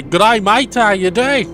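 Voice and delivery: put-on a light Australian accent, jokey greeting; mock Australian accent. Commentator greets his Australian co-host in a mock Australian accent. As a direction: do a quick Australian accent for a laugh. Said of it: "Or should I say".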